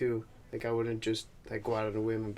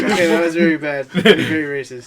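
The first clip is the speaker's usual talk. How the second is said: loud, laughing, mock-offended; laughing protest; 4 semitones higher, 13 dB louder than usual. Real, not acted. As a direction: laugh it out, scolding in jest.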